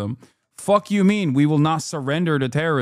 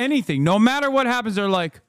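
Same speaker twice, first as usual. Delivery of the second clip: usual speaking voice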